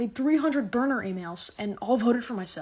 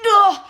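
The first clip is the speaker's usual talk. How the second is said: loud high scream of fright; startled loud gasp; 11 semitones higher, 14 dB louder than usual. Acted. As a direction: shriek like you were caught. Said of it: "This is me if someone's behind me".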